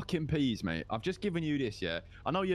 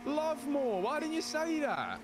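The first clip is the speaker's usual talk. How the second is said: surprised; 11 semitones higher, slower than usual. Acted. Surprised recognition.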